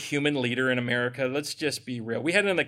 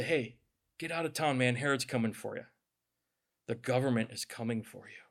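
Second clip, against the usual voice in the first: hushed ominous warning; 6 semitones lower, 9 dB quieter than usual. Acted. Pharisees' warning acted out.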